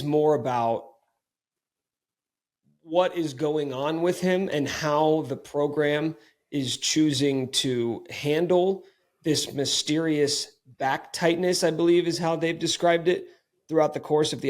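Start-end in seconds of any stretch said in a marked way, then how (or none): none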